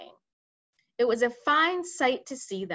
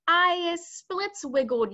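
higher, slow, louder giant yell; 8 semitones higher, 4 dB louder, slower than usual. Acted. Childchewer yelling from his chains, slow and high. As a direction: drag out the complaint like a tied-up brute. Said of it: "yelled the child chewer".

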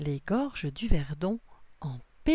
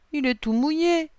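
put-on high, louder childlike complaint; sighing dismay; 9 semitones higher, 5 dB louder than usual. A narrator's reading. Lily finds the hat soaked, sighing dismay. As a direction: sigh it out, mildly upset. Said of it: "soupire Lily".

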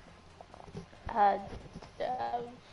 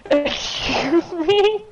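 mock offended; 3 semitones higher, 7 dB louder, much slower than usual.

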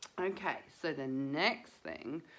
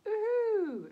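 very high, loud puppet "woohoo"; puppet cheer; 7 dB louder than usual. Pretend. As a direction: cheer the kids on as the teddy.

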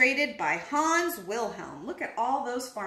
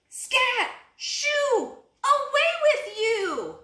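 high animal voices; 8 semitones higher, slower than usual. A narrator's reading. Farmer chasing animals away.

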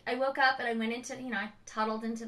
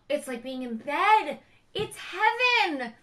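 slow, high, louder gush of bliss; blissful rave; 5 semitones higher, 6 dB louder, slower than usual. Real, not acted. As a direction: savor the word heaven. Raving about comfy lounge chair.

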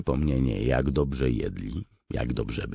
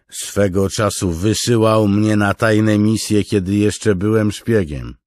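boastful interjection; 9 semitones higher, 11 dB louder than usual. Acted. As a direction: cut in with a tall tale. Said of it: "wtrącił mężczyzna o zarośniętych rękach".

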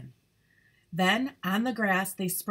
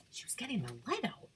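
whisper; 3 semitones higher, 10 dB quieter, much faster than usual. Shown as a demonstration. Repeats Clover's line as a hush.

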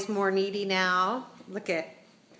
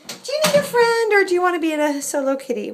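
high, loud cooing question; pet talk; 9 semitones higher, 7 dB louder than usual. Real, not acted. Owner asking the cat about a friend, high pet talk.

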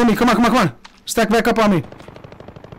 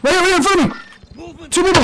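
high, urgent enemy callout; excited callout; 10 semitones higher than usual. Real, not acted. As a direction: call out the enemies fast. Enemies spotted in fight.

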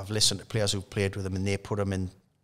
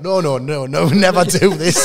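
laughing; 7 semitones higher, 9 dB louder than usual. Retold or imitated. Pleading with boss, laughing.

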